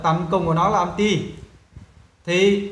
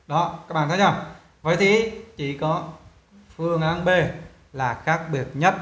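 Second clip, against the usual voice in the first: normal talking voice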